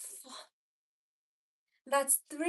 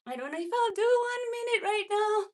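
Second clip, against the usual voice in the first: straining; 8 semitones higher, 4 dB louder, faster than usual. Real, not acted. Straining mid plank hold.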